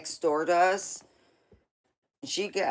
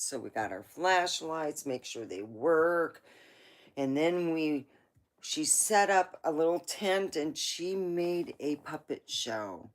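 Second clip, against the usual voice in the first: ordinary talk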